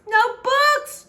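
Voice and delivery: high wailing cry of no books; bewailing. Acted. Princess bewailing to the brambles, loud high wail. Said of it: "she bemoaned".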